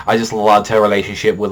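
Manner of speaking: usual speaking voice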